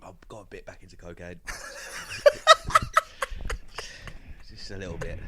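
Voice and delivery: quiet, giggly confession; sheepish laughing admission. Real, not acted. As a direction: admit it with a guilty laugh. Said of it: "The way you said that".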